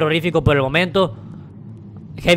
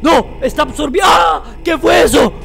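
panicked screams; 6 semitones higher than usual. Real, not acted. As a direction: shriek at the screen in real fright. Dark creature appears, he panics.

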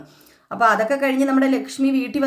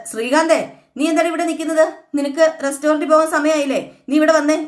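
higher, louder scolding of a son-in-law; angry scolding; 6 semitones higher, 4 dB louder than usual. Retold or imitated. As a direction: snap at him to come away now. Mother-in-law scolds Sreekanth. (ദേഷ്യത്തോടെ ശകാരിക്കുന്നു)

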